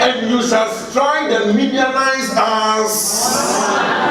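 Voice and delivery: slow proclamation. Acted. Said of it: "And the Lord said unto him".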